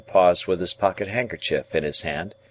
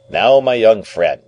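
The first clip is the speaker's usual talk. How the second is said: louder, higher opening, as a lawyer to a witness; addressing a witness; 4 semitones higher, 7 dB louder than usual. A narrator's reading. Guardian opens formally to his young ward, like questioning a witness. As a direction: address them like a witness in the box. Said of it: "as if I were a witness in the box".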